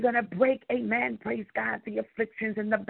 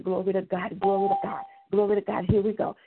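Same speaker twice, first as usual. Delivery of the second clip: ordinary talk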